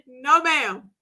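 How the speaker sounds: usual speaking voice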